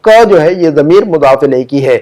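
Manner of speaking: ordinary talk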